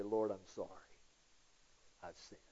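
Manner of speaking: very soft, low acted prayer; penitent confession. Sinner coming back to the Lord after a pity party, hushed confession. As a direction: let the apology sink to a near-hush. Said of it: "come to this place and say".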